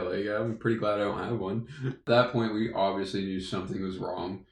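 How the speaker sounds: ordinary delivery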